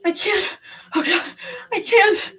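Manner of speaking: high, broken sobbing; crying despair. A narrator's reading. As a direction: plead through tears. Sobbing breakdown.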